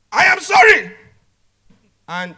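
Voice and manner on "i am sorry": shouted, high and very loud insincere sorry; angry apology. Shown as a demonstration. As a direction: snap out the apology at full volume. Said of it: "Same sentence, two different meaning".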